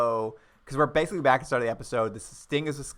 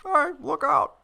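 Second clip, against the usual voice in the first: high strained warning call; mock yell; 14 semitones higher than usual. Brogan's plan to stop the gunman, yelling. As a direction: call it out through a window. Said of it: "he's just gonna yell through the window".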